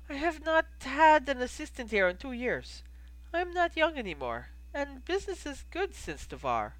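put-on accented innkeeper. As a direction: play the innkeeper in an accented, lifted voice. Plays innkeeper Goldie.

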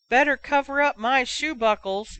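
chortling jeer from the next aisle; yelling fan. Acted. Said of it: "yelled one chortling fan".

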